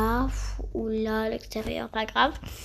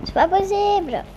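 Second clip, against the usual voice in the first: exasperated exclamation; 8 semitones higher, 6 dB louder than usual. Real, not acted. Game finally working after bug.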